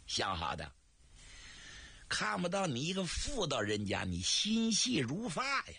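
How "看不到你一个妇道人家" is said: usual speaking voice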